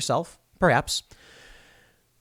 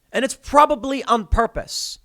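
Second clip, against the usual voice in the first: normal talking voice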